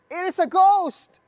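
high, loud terrified shout; terrified cry. A narrator's reading. Disciples on the lake, shrieking in terror. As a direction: cry out like the disciples seeing a ghost. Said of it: "they were terrified and said".

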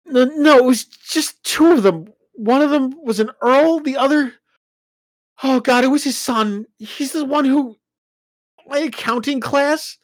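high, loud, upset; anxious explanation. Acted. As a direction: explain it, still shaken.

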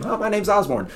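high squeaky little voice. Acted. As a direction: pitch up like a tiny shy guy. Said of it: "a little guy that never said his name".

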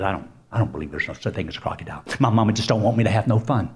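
quick, low grumble to himself; hurried, defiant muttering; grumbling little monkey. Acted. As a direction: rattle off the doubts under your voice. Sulky little monkey doubts his mother.

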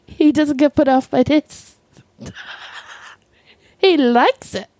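usual speaking voice

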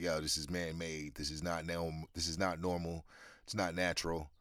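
normal talking voice

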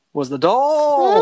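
high, loud, slow horror reveal; spring the scary ending on them; dramatic reveal. Acted. Scary story climax reveal.

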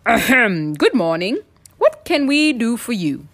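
lightly voiced chief frog, throat cleared first; chief frog speaking up. A narrator's reading. Chief frog to the surprise visitor, speaking up politely. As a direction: clear the throat, then address the newcomer formally. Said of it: "the chief frog spoke up".

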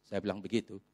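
usual speaking voice

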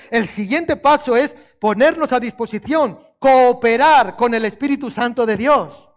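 loud exhortation; preaching. Acted. Preacher urges the congregation to serve, loud. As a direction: urge them on, loud.

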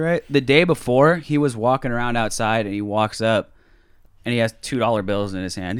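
ordinary talk, nothing marked

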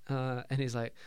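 ordinary talk

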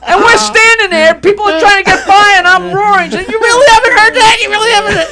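loud, high, elated shouting over laughter; roaring excitement. Real, not acted. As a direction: tell it at the top of your voice, cracking up. Roaring over laughter at retold reaction.

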